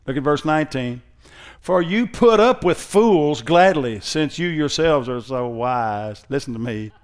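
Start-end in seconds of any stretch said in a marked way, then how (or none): 1.6-6.2 slow, drawn-out sarcasm; sarcastic. Acted. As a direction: stretch out the mock praise, letting the pitch sink at the end. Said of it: "Can you not hear the sarcasm in it".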